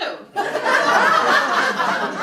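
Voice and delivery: loud high cackle; a loud, high, delighted cackle; big cackling laugh. Acted. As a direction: cackle at your own joke. Laughing at her own joke.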